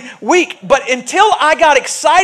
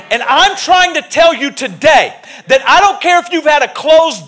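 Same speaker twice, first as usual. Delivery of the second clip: Pentecostal preaching; 4 semitones higher than usual. Real, not acted. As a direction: preach it at full fire like a Pentecostal. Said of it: "I feel like a Pentecostal preacher today".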